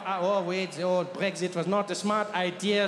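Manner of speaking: normal talking voice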